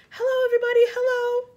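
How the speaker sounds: very high, very loud greeting; happy calling out. A narrator's reading. Small boy waving from the peach, calling out. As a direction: holler a joyful hello to those below. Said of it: "laughing and waving and calling out".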